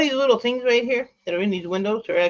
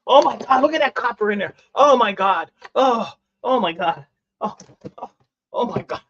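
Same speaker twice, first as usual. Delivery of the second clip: higher, louder thrilled exclamations at the copper; excited oh my God; excited gasps; 4 semitones higher, 4 dB louder than usual. Real, not acted. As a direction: gush over the shiny copper. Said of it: "I'm so excited right now".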